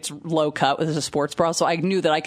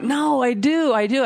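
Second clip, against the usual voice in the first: higher-pitched mock protest; retold insistence; 7 semitones higher, slower than usual. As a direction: imitate her insisting in an argument. Retold fight over attraction.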